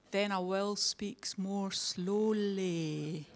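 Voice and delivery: very slow, drawn-out words. Shown as a demonstration. Speaker mocks complaints about her accent, very slowly. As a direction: stretch every word out slowly. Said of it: "but I'll speak more slowly".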